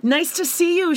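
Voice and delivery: stiff greeting. Retold or imitated. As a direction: imitate an awkward, forced hello. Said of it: "Garrett goes".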